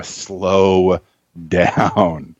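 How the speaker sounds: slow, stretched advice; drawn-out slowing. Retold or imitated. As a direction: stretch each word to slow them down. Tutor telling students to slow down.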